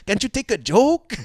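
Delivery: put-on high, loud protest in Mexican accent; mock Mexican accent. His people scolding him over the clip, mock Mexican accent. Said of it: "mi gente are gonna go".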